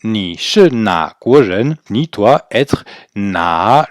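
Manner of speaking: ni shi na guo zhen spoken very slowly, word by word; syllable-by-syllable teacher reading; slow model sentence. Shown as a demonstration. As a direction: read it out slowly for learners to copy.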